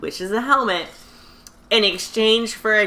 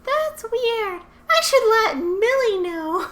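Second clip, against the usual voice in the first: sweet, naive little sister; little-girl voice; slower than usual. Retold or imitated. Nunnally noticing the cat, high innocent voice. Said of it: "Nunnally's like".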